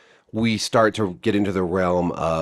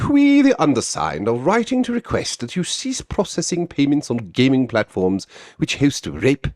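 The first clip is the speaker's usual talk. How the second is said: put-on pompous British accent; 3 semitones higher than usual. Vlogger mocking the group's open letter, pompous British accent. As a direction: read it like a self-important Brit. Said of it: "a pompous British accent".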